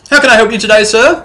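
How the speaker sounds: high, loud eager greeting; a servant keen to please. Acted. Servant greeting the trader eagerly, high and loud.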